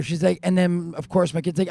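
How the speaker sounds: normal talking voice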